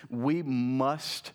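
"must" drawn out slowly for weight; slow down and lean on "must"; stress on must. Shown as a demonstration. Preacher reading the verse to the congregation, stress on "must". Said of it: "emphasize the word must there".

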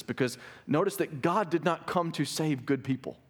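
ordinary delivery

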